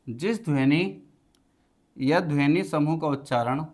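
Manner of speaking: teacher dictating a definition to the class; slow dictation. Acted. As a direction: dictate word by word with long pauses so students can write.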